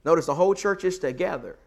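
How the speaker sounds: ordinary talk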